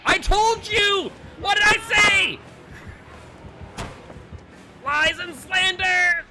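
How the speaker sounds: furious mock-outrage scream; triumphant shout. Real, not acted. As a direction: shout it, vindicated. Vindicated after teammate lied.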